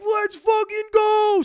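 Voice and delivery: high, loud, slow cheer; hyped shout. Real, not acted. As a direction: yell it like the best news ever. DJ is coming back on.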